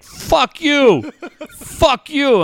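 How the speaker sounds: high-pitched mock outraged retort; offended phone scammer. IRS scam caller curses back at dad, high mock outrage. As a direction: imitate an insulted caller, voice jumping high. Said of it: "the guy from the I R S is like".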